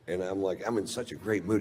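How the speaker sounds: usual speaking voice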